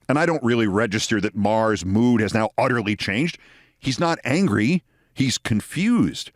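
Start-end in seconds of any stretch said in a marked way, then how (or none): none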